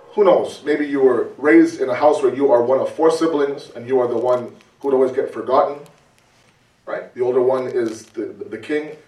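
ordinary delivery

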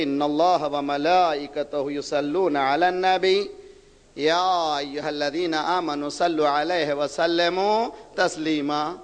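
slow, low, measured recitation; sustained, melodic Arabic recitation; Quran recitation. Real, not acted. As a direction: recite the verse with lengthened vowels.

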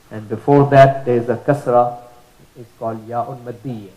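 usual speaking voice

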